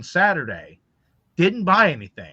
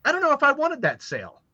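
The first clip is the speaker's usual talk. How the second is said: bemused disbelief; 11 semitones higher, 3 dB louder than usual. Retold or imitated. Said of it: "And I was like".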